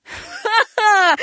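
high, drawn-out ha-ha; old man's chuckle. Acted. Old man laughs at foolish boys, old man's chuckle. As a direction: chuckle at them in mockery. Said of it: "похатывался старик".

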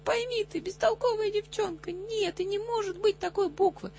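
high-pitched angry outburst; exasperated shouting. A narrator's reading. Angry tutor makes the girl repeat after him, crying out. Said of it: "закричал я".

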